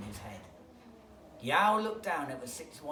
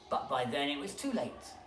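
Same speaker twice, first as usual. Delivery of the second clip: ordinary delivery, nothing marked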